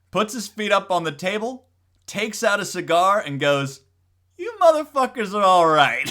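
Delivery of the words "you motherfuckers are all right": loud, high punchline breaking into laughter; hammed-up gleeful approval, pushed high and loud; grinning Pope. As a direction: deliver it big with a delighted grin. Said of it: "Then he grins".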